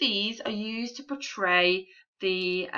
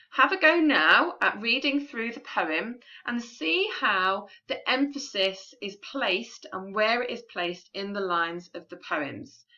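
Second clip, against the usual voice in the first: usual speaking voice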